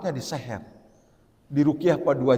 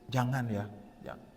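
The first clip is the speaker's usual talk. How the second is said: soft, lowered fatherly admonition; hushed, gentle parental warning; softened; 3 semitones lower, 10 dB quieter than usual. Shown as a demonstration. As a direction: gently tell a child no, voice dropped. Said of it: "nah, dilembut".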